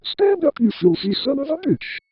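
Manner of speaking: mild narrated yell. A narrator's reading. Old woman abusing the boy, a mild yell. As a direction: raise the pitch sharply without full volume. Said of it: "the woman yelled".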